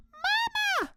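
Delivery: tiny high voices calling from afar; distant tiny call; calling from far off. Acted. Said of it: "they called".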